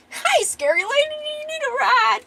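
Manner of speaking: loud, squeaky-high little creature voice; Bongo. Acted. Funny little wagon thief offers a ride, piping cheerfully. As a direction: pipe it up very high and loud, cartoonish. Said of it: "this little funny Khajiit dude".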